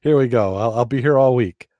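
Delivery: loud, hammy stand-up comic; brash vaudeville comic. Pretend. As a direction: play to the back row like a club comic. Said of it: "I can see you on stage now".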